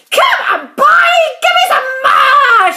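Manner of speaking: put-on high, loud screech of an old woman; yelling crone. A narrator's reading. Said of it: "she yelled".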